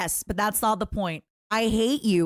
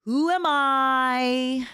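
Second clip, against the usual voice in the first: dramatic drawl; 5 semitones higher, 3 dB louder, much slower than usual. Shown as a demonstration. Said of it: "repeated for drama".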